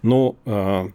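ordinary talk

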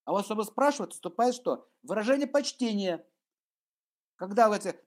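ordinary talk, nothing marked

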